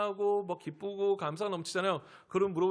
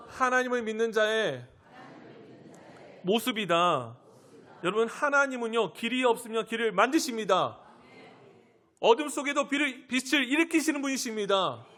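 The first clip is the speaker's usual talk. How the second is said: raised, louder preaching voice; impassioned preaching; 3 semitones higher, 4 dB louder than usual. Real, not acted. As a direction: proclaim it forcefully, pitch climbing. Preacher builds to climax. (힘주어 선포하는 설교조)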